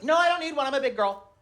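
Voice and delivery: put-on high, indignant little-girl voice; high-pitched kid protest; protesting daughter. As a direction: insist like a child who feels grown up. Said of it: "my daughter's like".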